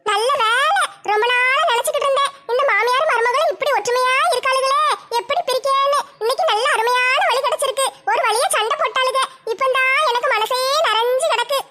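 delighted busybody savouring the quarrel; sly neighbour's gleeful aside; scheming gossip neighbour. Acted. Gossip neighbour gloats over fight.

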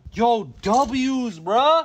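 loud, high victory call; hyped celebration. Real, not acted. Streamer calling for wins from chat, hyped celebration. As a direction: hype up the crowd loudly.

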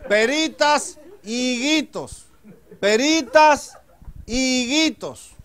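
put-on high, drawn-out child fruit seller's call; street vendor's cry. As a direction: hawk fruit down the street, stretching each word. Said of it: "va pregonando por la calle". (pregón de niño frutero, agudo y alargado)

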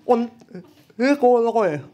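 cork in mouth; garbled around a mouthful; mouth full. Real, not acted. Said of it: "das spricht eher undeutlich".

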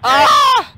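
short, high, loud playful scream; mock scream. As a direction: let out a theatrical high shriek. Mock scream during anime quote.

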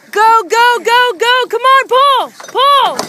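cheering on. Real, not acted. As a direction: yell them on to pull. Crew hauling the fish aboard.

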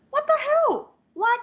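high, loud exasperated what the hell; outraged shout. Retold or imitated. Daughter shouting at her dad over the coffees. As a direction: blurt it out in loud disbelief.

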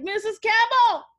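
loud, high, drawn-out greeting; hammed-up high call to a mom. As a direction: hail the parent loudly, stretching the name. Acts out phoning the mother.

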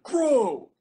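one long, high shouted word; very loud high yell, falling away. Retold or imitated. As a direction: bellow it like a raiding cop. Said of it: "while repeatedly yelling".

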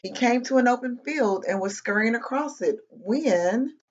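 animated read-aloud with bouncy pitch; children's story narration. A narrator's reading. Reading a picture book to kids, lively storytelling. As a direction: tell it to little listeners, pausing before each mishap.

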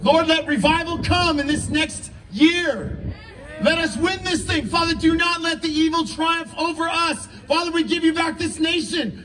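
calling on the Father with full conviction; fervent prayer. Acted. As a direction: lift the pitch and plead, building to the end. Closing prayer rising in force.

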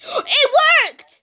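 high, loud cry of joy; excited cry. Real, not acted. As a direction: shout it like you can't believe it. Command suddenly works.

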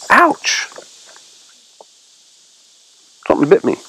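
real yelp at a sudden bite; pained surprise. Real, not acted. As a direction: caught off guard by a sting. Something just bit the speaker.

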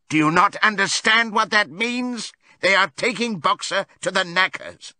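high, desperate appeal; urgent warning. A narrator's reading. Benjamin explaining the sign to the animals, urgent warning. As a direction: drive it home in a high, alarmed voice.

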